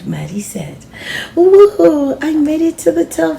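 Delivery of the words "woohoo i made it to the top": high, loud, fast cheer of triumph; excited shout. Acted. Girl shouting from the top of the wall, excited. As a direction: whoop it out from the top. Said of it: "Sophia shouted".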